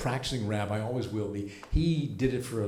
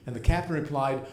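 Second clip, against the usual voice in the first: ordinary talk, nothing marked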